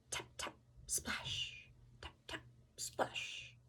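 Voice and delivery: slow, hushed footstep sounds; whispered tap tap splash. A narrator's reading. Narrator whispering the frog's steps up the stairs, slow. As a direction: whisper the creeping steps, slowly.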